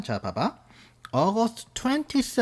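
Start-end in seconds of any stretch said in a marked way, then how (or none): 1.1-2.4 careful spelling note. Shown as a demonstration. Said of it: "이게 잘 봐야 된다".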